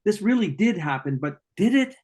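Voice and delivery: mock self-doubt rising into a question; voicing that nagging imposter doubt; doubting inner voice. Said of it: "and just say".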